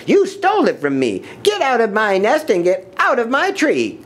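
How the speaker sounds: high, scolding bird voice; hammed-up indignant squawking; sputtering outrage. A narrator's reading. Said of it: "she sputtered".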